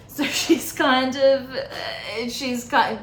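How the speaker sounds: words broken up by real laughter; laughing. Real, not acted. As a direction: laugh through it, slowing down. Laughing mid-sentence.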